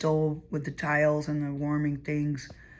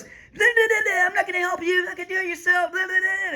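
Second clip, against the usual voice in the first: much higher, mocking rant voice; mocking blah blah; 12 semitones higher than usual. Mocking the man's tirade as he leaves, sneering. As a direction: parody his ranting as nonsense.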